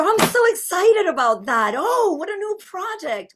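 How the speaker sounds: put-on high, gushing child's delight; excited child. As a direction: bubble over in a high kid voice. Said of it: "what does a child sound like?".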